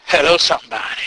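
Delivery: ordinary talk, nothing marked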